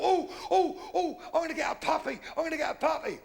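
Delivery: put-on high, thrilled squeal of a child; giddy childish glee; excited child. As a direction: gush like a kid at Christmas. Child excitedly guessing present.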